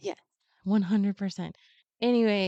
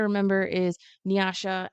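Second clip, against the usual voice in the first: ordinary delivery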